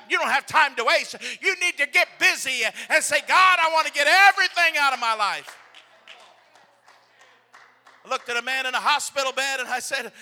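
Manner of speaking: loud, urgent sermon voice; preaching at full force. Acted. Preacher urging the congregation not to waste time, loud. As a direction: press the point home loudly.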